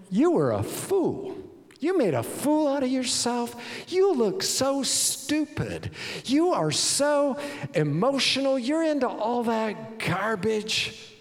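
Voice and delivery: high-pitched, drawn-out contempt; hammed-up disgusted wife; scornful wife. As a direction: belittle him, leaning slowly on the insults. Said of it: "And she's like".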